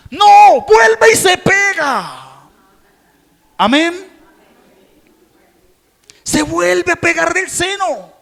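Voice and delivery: loud high fervent preaching; impassioned pulpit sermon; preaching at full force. Acted. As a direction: pour it out to the congregation, full volume.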